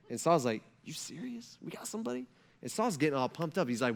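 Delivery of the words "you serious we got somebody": very soft, higher incredulous question; hushed disbelief. Retold or imitated. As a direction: ask it under your breath, barely believing. King hears rumor, hushed disbelief.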